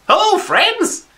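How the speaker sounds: high, booming hello from the shadows; sudden loud, high-pitched hail; loud greeting. Acted. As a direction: call it out loud and high to startle them. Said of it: "he'd heard me speaking quite loudly".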